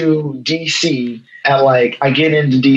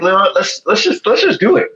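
eager urging; 6 semitones higher than usual. Retold or imitated. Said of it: "it's like".

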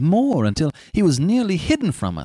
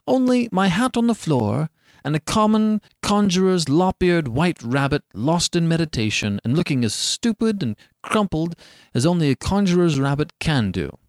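usual speaking voice

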